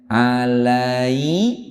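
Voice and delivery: alaihi drawn out slowly, recited; teacher recites alaihi slowly for the class; Quran recitation. Shown as a demonstration. Quran teacher shows an example, slowly recited.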